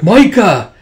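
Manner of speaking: high, loud shout of a name; urgent calling out. Acted. Tuya to Micah in battle, urgent high call. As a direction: call to snap someone back.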